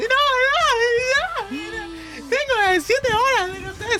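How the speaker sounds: very high-pitched mock wailing; imitated screaming fans. Imitating fans who traveled hours to a cancelled show, shrieking. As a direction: squeal it like hysterical, devastated concertgoers. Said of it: "Todos gritando así".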